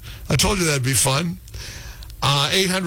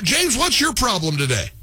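normal talking voice

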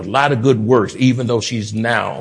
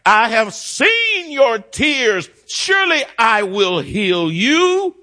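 preaching; 6 semitones higher than usual. Acted. Preacher reads God's promise to Hezekiah, preaching.

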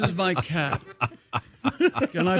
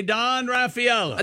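hammy Italian accent, a bit higher; 6 semitones higher than usual. Shown as a demonstration. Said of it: "Should we do it with an Italian accent?".

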